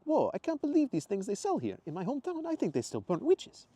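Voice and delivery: excited tourist in a put-on accent; Mateo's accent. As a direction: put on Mateo's foreign lilt. Mateo, foreign character voiced.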